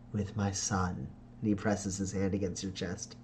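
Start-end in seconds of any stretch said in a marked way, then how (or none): 0.1-1.1 low, soft and lingering; a hint of a fond father; lightly voiced tender father. [name] quietly claims time alone with his son.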